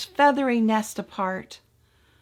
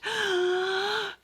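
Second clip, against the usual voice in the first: high sneeze gasp; 10 semitones higher, 3 dB quieter than usual. Acted. Mouseling about to sneeze.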